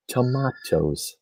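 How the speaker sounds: "Tomatoes" echoed softly, slowly as to-MAH-toes; mock broad-A pronunciation. Host echoes co-host's joke, "Tomatoes" slowly said to-MAH-toes. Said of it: "I say tomatoes".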